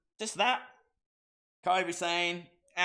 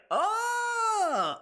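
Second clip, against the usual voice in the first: mock cry. Real, not acted.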